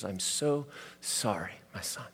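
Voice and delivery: a father's quiet sorry to his son; tender comfort. Retold or imitated. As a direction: soothe a crying child at bedside. Dad comforts crying son.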